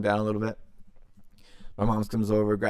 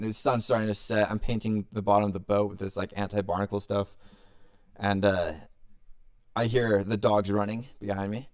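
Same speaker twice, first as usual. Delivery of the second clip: usual speaking voice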